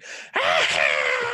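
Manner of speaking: wild cackling laugh; maniac laugh. Retold or imitated. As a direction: cackle like a crazed gremlin. Maniac Gremlins laugh described.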